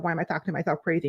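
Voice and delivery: quick, low and soft self-talk; self-questioning. Acted. Asking herself about her own self-talk, quick low self-questioning. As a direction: ask it softly and fast, as if to yourself. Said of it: "you ask yourself".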